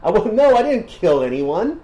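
high-pitched goofy surfer bro; squeaky laid-back dude. Retold or imitated.

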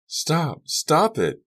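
high, urgent female shout; called-out female voice. A narrator's reading. Girl calls from across the courts, high urgent shout. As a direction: cry out to halt a fight. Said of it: "a female voice, called".

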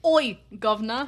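mock British street greeting; hammed-up British "oi, guv'nor"; British accent. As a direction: put on a cheeky British accent. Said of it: "I'm actually British".

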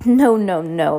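loud, emphatic storybook refusal. A narrator's reading. Madame Lulu refusing to pay the freaks, emphatic. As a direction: read it as a firm, loud refusal.

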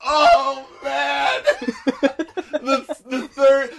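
laughing in secondhand embarrassment; cringing laugh. Real, not acted. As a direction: wince and laugh through it. Reacting to embarrassing song.

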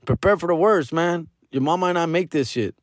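high doctor voice; doctor's warning. Retold or imitated. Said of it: "he's like".

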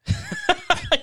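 high burst of laughter. Real, not acted. Host reacts to caller.

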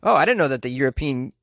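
ordinary delivery

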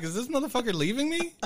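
high, alarmed disbelief at the empty room; shocked disbelief. Retold or imitated. Retelling to his cohost, a high shocked what-the-hell. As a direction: spit it out like you just got ditched. Finding roommate's stuff moved out.

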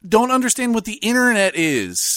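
high, quick comic outrage; Aziz Ansari impression. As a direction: channel Aziz Ansari's riff. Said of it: "you said that like Aziz Ansari".

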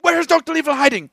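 loud high hammed-up interrogation; mock shout. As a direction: yell the lines back and forth. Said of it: "interrogation bit".

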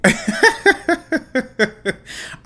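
a loud, high, real burst of laughter at herself; big laugh. Real, not acted. Catching herself mid-rant, a loud real laugh. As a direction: laugh out loud at your own ranting. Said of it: "I am ranting".

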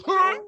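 sudden high yelp of surprise; high startled grunt. Acted. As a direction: squeak out a shocked huh. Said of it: "And she goes".

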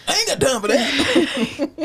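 slow, drawn-out, laughing Sweet Brown voice; slow, laughing meme voice; Sweet Brown impression. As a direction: quote the viral line slow and amused. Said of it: "Like Sweet Brown".

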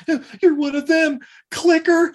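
high, loud mock yell; accusing kid. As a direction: cry it out like an alarmed kid. Retold kid accusing the captain.

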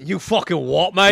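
echoed mock Cockney challenge; Cockney accent. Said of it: "you gotta go straight Cockney".

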